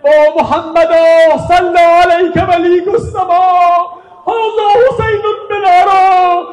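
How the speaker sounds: high, slow wailing cry of grief; mournful loud lament. Acted. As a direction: cry it out to the heavens, slowly and mournfully. (فریاد سوزناک و کشیده با صدای بلند)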